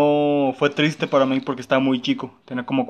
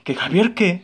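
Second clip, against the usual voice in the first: startled mother; 5 semitones higher, slower than usual. Retold or imitated. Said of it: "me acuerdo muy bien que dice".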